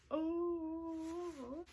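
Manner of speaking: long high-pitched "oh" squeal; drawn-out high cry of delight; happy squeal. Retold or imitated. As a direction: re-enact an overjoyed squeal. Acting out her happy reaction.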